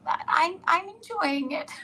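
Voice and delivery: high, whimpering reluctant yes; mock whimper. Amy answering while soaked, high mock whimper. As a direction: whimper it out, miserable. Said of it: "she's like this".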